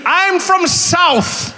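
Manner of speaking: very slow, high, loud declaration; imitated insistent local. Local answering a puzzled visitor, slow high mock insistence. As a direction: stretch it out as if it explains everything.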